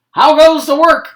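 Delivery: bossy farmer shouting from bed; lazy farmer calling. A narrator's reading. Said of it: "called the farmer".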